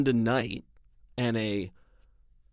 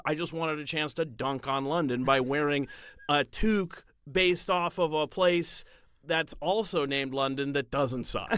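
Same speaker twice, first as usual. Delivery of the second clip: ordinary talk, nothing marked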